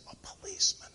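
put-on soft, secretive child's whisper. Little boy telling who else is there, whispering. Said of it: "came the whispered voice".

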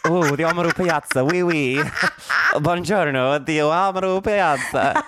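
put-on high, hammy Italian accent; terrible Italian accent. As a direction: ham up a bad Italian accent. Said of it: "it's a terrible Italian accent".

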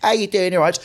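comic imitating his fellow comedian, light London voice; Rob impression. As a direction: tease a mate with a chirpy London hello. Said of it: "I end up speaking like both of them".

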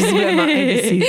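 high, laughing self-mockery. Real, not acted. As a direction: laugh through the words. Laughing at her own indecision.

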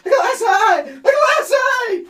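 high, loud crazed shout, dragged out slow; hammed-up manic wail over a trinket; laughing and weeping. [name] losing his mind, dancing around shouting. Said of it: "laughing and weeping".

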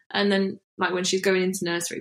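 usual speaking voice

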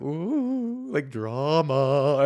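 campy thrilled gossip; mock delighted. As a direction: savor the gossip. Comic watching couple fight.

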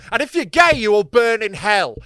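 sudden high, loud mock preaching; mock hellfire scripture. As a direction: thunder it like a damning sermon. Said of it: "it's just such a tonal shift".

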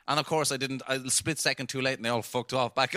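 ordinary delivery

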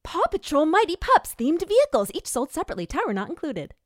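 put-on high, loud kids' toy ad voice; excited high-pitched announcer, very loud; toy commercial announcer. Shown as a demonstration. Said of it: "so here's my Paw Patrol".

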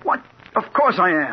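high, loud, indignant comeback; indignant retort. Acted. Reporter answers captain doubting his resolve, indignant. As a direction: fire back at the doubt.

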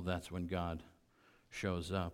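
ordinary talk

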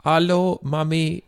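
put-on high little-kid voice in a German accent drifting Indian; wobbly mock German accent. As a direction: attempt a childlike German accent, sliding into Indian. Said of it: "with a German accent in English".

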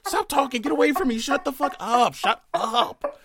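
high, loud shocked shrieking; fear turning to anger. Real, not acted. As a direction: scream it in outraged disbelief. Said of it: "I was screaming the entire conversation".

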